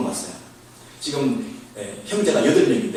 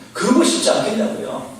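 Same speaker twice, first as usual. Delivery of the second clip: ordinary talk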